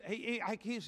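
normal talking voice

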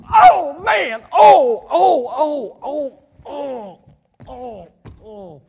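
loud high wails dying away; acted hurt groaning, shrinking away; hammed-up cries of pain. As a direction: howl in pain, then fade to small moans. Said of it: "hit yourself and you go".

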